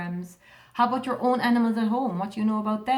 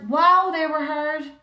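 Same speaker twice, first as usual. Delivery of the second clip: impressed; 7 semitones higher, 8 dB louder, slower than usual. Real, not acted. Praising last week's hard words.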